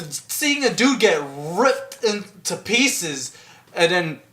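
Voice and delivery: ordinary talk, nothing marked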